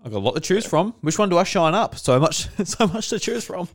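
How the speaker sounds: high-pitched mock delight at a find; imitating delighted co-host. Teasing Ash about bowling balls under the house, mock delight. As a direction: gush in a teasing, hammed-up imitation. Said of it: "You're like".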